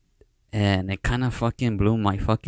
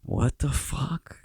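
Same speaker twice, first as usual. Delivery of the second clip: higher, drawn-out disbelief; stunned reaction; 5 semitones higher, 5 dB quieter, much slower than usual. Retold or imitated. Artist reacting to being recognized by a stranger, stunned. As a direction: re-enact being slowly floored, pitched up. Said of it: "And I was like".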